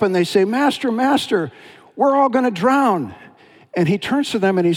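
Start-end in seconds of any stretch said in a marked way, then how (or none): 0.4-3.1 panicked disciples. Acted.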